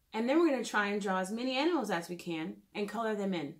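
ordinary delivery, nothing marked

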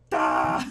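loud sudden yelp; loud shocked yell; startled scream. Real, not acted. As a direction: cry out at the jolt. Jump scare in elevator.

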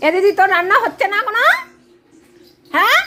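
the pet nagging its sister about the late dinner, a bit quick and pushy; pet scolding sister. Pretend. Pet voiced complaining to sister about dinner, mock scolding. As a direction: hurry up, you're late with the cooking. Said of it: "দিদিকে বকছে".

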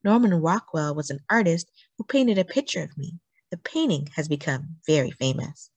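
plain reading voice

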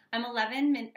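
ordinary talk

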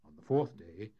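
plain reading voice

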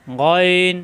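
গইন voiced from the back of the throat, strong; heavy throaty গইন; throaty Arabic letter. Shown as a demonstration. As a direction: rasp গইন from the end of the throat. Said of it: "কণ্ঠনালীর শেষাংশ থেকে".

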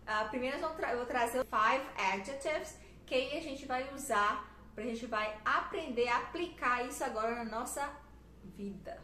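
ordinary delivery

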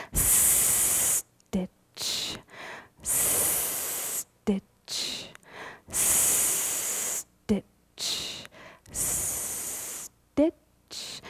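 breathy whispered stitch; hushed rhythmic whisper. Acted. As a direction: barely voice each stitch.

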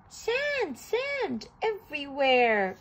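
a mild cry of despair. A narrator's reading. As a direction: cry it out like a thirsty, lost penguin. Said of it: "cried Sugar".

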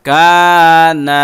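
কানা with both vowels held one alif long; one-alif stretch. Shown as a demonstration. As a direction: draw out the আ in কানা evenly. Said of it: "খালি আলিফ মদের হরফ ... টান দিতে হবে". (কানা শব্দে এক আলিফ টেনে পড়া)

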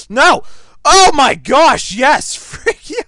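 loud, high, breaking into laughter; frantic gamer celebration; triumphant yelling. Real, not acted. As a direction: shout in wild excitement at the kill. Chase and kill in game.

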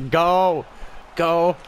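loud, high go go; loud celebratory urging; excited shout. Real, not acted. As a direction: cheer your player on. Celebrating, urging his player.

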